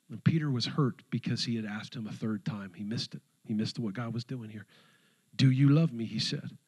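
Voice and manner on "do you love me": loud, pressing ask; forceful question. Retold or imitated. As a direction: push the question hard. Jesus's question repeated with force.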